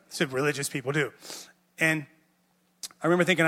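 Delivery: ordinary talk, nothing marked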